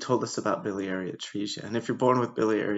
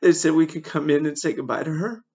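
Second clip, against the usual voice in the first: higher, louder, in distress; grief-stricken; 6 semitones higher, 7 dB louder than usual. Real, not acted. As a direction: struggle to get the awful choice out. Recalls choice to say goodbye.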